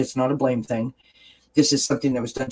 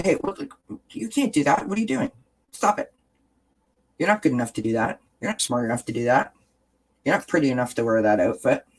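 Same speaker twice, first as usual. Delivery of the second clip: inner critic voice; faster than usual. Retold or imitated. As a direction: play the mean voice in your head. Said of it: "that negative critical voice that's in our head".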